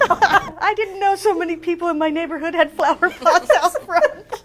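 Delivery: high, laughing through the words. Real, not acted. Woman laughing with friends about neighborhood flower pots. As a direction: giggle while admitting it.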